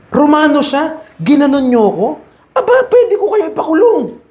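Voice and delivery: high, mock-outraged threat breaking into a laugh; a hammed-up indignant Roman citizen; indignant Paul. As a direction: play Paul threatening jail, half laughing. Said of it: "Parang ganun".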